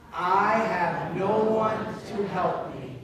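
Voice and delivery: slow, loud, drawn-out unison reading; loud read-aloud. Shown as a demonstration. Preacher leading the congregation in reading a verse, loud read-aloud. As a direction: read it out big and slow for everyone.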